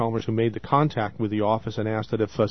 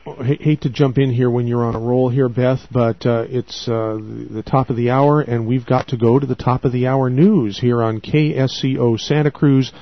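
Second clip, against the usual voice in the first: radio announcer; 7 dB louder than usual. Acted. Host announces top of hour.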